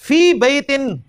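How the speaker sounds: फी बैतिन read slowly, stretching the ending of बैतिन; teacher reciting the hadith phrase slowly; slow recitation. Shown as a demonstration. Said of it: "वमज्तमा कौमुन".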